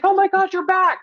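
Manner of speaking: bright overjoyed welcome-back cry; thrilled greeting. Retold or imitated. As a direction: friend spotting her return, high excited squeal. Friend greets her returning, thrilled.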